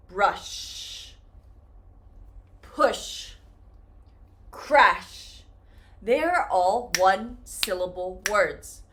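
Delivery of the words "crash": normal talking voice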